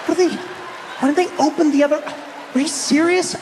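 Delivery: high-pitched, exasperated disbelief in fragments. Acted. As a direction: sputter in outrage, too appalled to finish. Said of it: "they just get flabbergasted".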